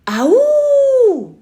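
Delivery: very high, loud yell. A narrator's reading. Boy yelling again as his uncle urges him. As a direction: throw it out even bigger. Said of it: "Then again".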